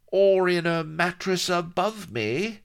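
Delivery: slow, very high, loud reply from under a bed; high-pitched underling piping up; pirate sidekick. A narrator's reading. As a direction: call it out slowly in a squeezed high voice. Vladimir calls from under bed.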